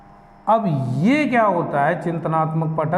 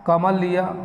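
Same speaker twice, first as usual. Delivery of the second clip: usual speaking voice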